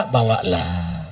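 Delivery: a lax mother answering quietly, no fuss; soft permissive mother. Retold or imitated. Mother carelessly allowing the boy to take her daughter, softly. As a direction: let it go easily, barely caring.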